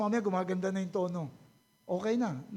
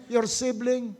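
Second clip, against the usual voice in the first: ordinary talk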